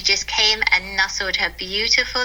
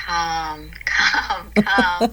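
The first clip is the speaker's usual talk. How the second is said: calm mantra; 3 semitones lower, much slower than usual. Retold or imitated. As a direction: hush yourself with each word. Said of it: "So I'm just like".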